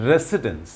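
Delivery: "রেসিডেন্স" with an s in the middle; wrong pronunciation. Shown as a demonstration. Teacher shows learners' habit, mispronunciation. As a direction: say "রেসিডেন্স" as "RE-si-dens". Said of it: "This is not residence".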